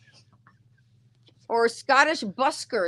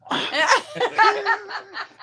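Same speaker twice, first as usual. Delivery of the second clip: high, real laugh; burst of laughter; 10 semitones higher, 3 dB louder than usual. Real, not acted. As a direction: crack up at yourself. Laughs after blurting the answer.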